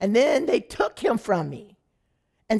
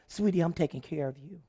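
softer, lower sweet reassurance; soothing; 3 semitones lower, 7 dB quieter than usual. Retold or imitated. Motel lady soothing the tired young mother, softer. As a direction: coo it gently to her.

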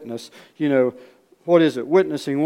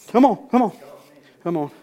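high, loud hurried call; urgent beckoning; 7 semitones higher, 5 dB louder than usual. Acted. Crying pastor calls the preacher over, urgent high plea. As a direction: wave him in with an acted cry. Acting pastor urging him in.

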